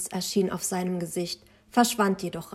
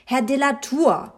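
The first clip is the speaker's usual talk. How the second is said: higher, more urgent call; insistent rousing; 5 semitones higher, 3 dB louder than usual. A narrator's reading. Narrator calls the absent father a second time, insistent. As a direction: call him sharply out of his daydream. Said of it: "ihn wach zu rütteln".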